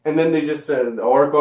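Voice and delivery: normal talking voice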